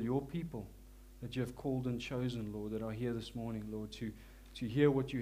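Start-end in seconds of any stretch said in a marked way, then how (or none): none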